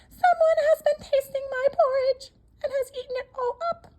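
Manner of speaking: put-on very high, loud baby bear cry; wee little voice. Baby bear finds his porridge eaten, wee high crying voice. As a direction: squeak it out as a tiny baby bear. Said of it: "cried in his wee little voice".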